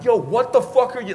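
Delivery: retold outraged confrontation; angry yell. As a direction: shout it at the men, hotheaded. Confronting thugs beating a woman.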